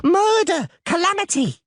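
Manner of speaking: high panicked yell for help; shrill-pitched panicked yell. A narrator's reading. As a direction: scream the alarm. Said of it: "it yelled".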